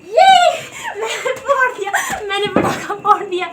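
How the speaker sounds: high, loud, laughing triumph; excited shout. Real, not acted. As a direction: shriek with delight as it bursts. Firecracker finally bursts.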